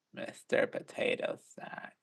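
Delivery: soft, slow raspy whisper of the name; gravelly whisper. Retold or imitated. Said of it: "It was a bit more like".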